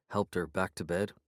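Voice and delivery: ordinary delivery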